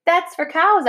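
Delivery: put-on a child's high protest; high-pitched cry. A narrator's reading. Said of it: "I cried".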